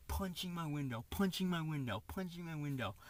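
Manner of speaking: normal talking voice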